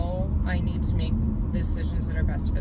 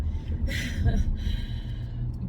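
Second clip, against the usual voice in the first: teary laugh; 6 semitones higher than usual. Real, not acted. Laugh after tearful moment.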